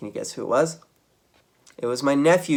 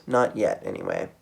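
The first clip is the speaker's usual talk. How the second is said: plain reading voice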